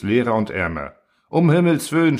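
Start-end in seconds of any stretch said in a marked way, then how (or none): 1.3-2.2 higher-pitched moan of dismay; groaning dismay. A narrator's reading. Constructor watching the world empty out, groaning in dismay. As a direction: groan it in acted alarm. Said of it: "stöhnte Truhl".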